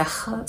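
H said as a German ch fricative; German CH sound. Shown as a demonstration. Teacher showing learners the German letter pair, German CH sound. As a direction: say H as the rasped German CH, unlike English "chair". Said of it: "C H is not like".

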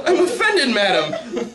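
high, prim hammed-up offence; mock offended. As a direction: play the offended gentleman.

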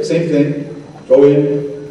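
normal talking voice

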